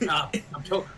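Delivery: choking through laughter; gasping out words mid-choke. Real, not acted. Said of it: "I'm choking on a chip".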